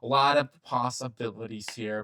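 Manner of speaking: normal talking voice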